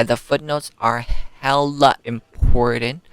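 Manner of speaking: ordinary talk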